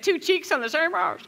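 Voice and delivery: mock Irish accent. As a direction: quote him in a playful Irish lilt. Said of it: "I'm gonna say this with an accent".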